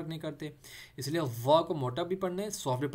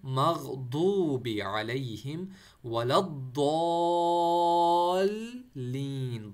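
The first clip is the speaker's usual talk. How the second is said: slow, measured tajweed recitation; तजवीद के साथ धीमी तिलावत; Quran recitation; 5 dB louder, much slower than usual. Shown as a demonstration. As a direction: recite it slowly, like the prayer. Recites Fatiha ending.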